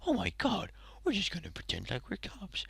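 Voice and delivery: a giggly conspiratorial whisper, mocking the characters; mock gleeful whisper. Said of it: "Then they're like".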